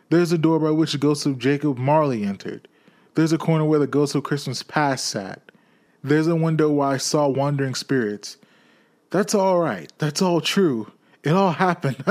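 high and loud, rushing with delight. Acted. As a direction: tumble out the memories in glee. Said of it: "it was a splendid laugh".

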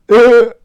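loud, high burst of laughter. Real, not acted. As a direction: laugh out hard. Laugh after hype yell.

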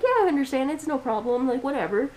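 sweet service-counter tone; customer service voice. Retold or imitated. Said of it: "put on my customer service voice".

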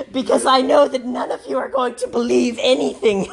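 ordinary talk

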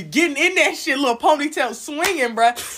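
loud, high and hyped; excited teasing. Real, not acted. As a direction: tease her loudly with glee. Excited teasing about friend's oral.

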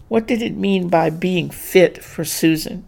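usual speaking voice

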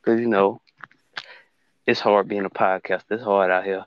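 normal talking voice

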